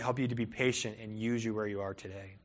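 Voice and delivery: ordinary delivery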